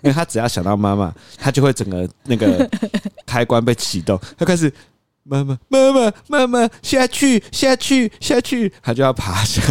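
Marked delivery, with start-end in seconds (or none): 5.2-8.7 much higher, slow insistent whine; imitating toddler. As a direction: beg for mom like a toddler. 模仿孩子要妈妈. (高音慢速模仿小孩吵着找妈妈)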